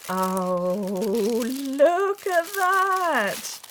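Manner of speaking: very slow, high, drawn-out wonder; awed. Real, not acted. Crafter unwrapping the gift, slow high awed gasp. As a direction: stretch it out in awe.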